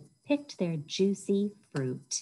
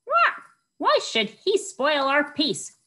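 loud, higher indignant squawk; a cross parrot complaining; angry parrot; 9 semitones higher, 4 dB louder than usual. Acted. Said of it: "squawked an angry parrot".

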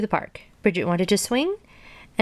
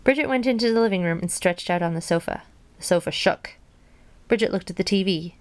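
plain reading voice

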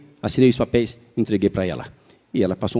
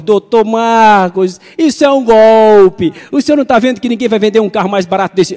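high, slow, loud alarmed warning; voiced saleswoman raising the alarm; clerk's warning; 9 semitones higher, 6 dB louder, slower than usual. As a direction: sound the alarm like a worried clerk. Clerk warns it's a scam.

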